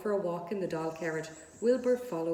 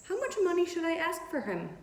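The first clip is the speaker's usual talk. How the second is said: lightly voiced girl, much higher and eager; a little girl's hopeful question, pitched up; young Fern asking; 10 semitones higher than usual. A narrator's reading. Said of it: "Fern wanted to know".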